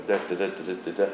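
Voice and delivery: soft, low "da, da" tapping the beat; quiet beat counted out on "da"; rhythm demo. Shown as a demonstration. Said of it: "a different rhythm".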